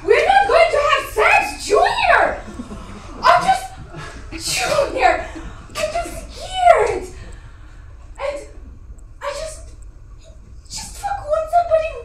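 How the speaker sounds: high, loud, desperate yelling; frightened woman yelling at Junior on the bridge; panicked shouting. Acted. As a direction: scream at him to make him understand.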